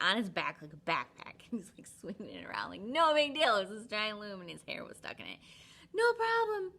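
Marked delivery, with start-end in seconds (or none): 5.9-6.7 high, loud breezy boast; cocky. Retold or imitated. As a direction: toss it off carelessly. Samson shrugging off loom.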